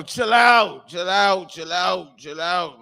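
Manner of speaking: high, slow shout fading to a mutter; fading mock girlfriend. Girlfriend telling the boys to chill, shout fading away. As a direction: she waves it off, winding down each time. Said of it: "She be like".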